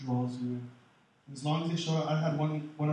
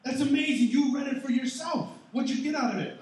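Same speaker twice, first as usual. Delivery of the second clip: higher, louder cheering praise; excited praise; 10 semitones higher, 4 dB louder than usual. Pretend. Pastor acting out cheering a new Bible reader, excited. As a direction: gush over their first small step.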